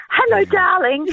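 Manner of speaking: high, sing-song coo to a dog. Shown as a demonstration. Caller shows how she greets dogs, dog voice. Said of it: "you know, dog voice".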